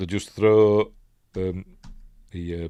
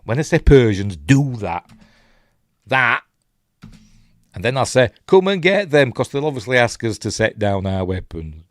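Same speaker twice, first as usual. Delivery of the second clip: louder mock king giving orders; joking imagined command; 4 semitones higher, 7 dB louder than usual. Leonidas rehearsing his men, mock loud orders. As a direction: bark the plan like a proud general.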